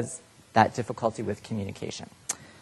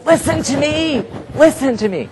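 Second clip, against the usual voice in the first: much higher and louder, exasperated; like you want to choke it out of them; exasperated plea; 7 dB louder than usual. Retold or imitated. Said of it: "you just wanna go".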